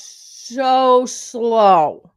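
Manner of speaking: very drawn-out, loud words; mocking slow-motion emphasis; exaggerated slowness. Shown as a demonstration. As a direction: stretch "so slow" out as far as it goes. Exaggerates how slowly housemate talks.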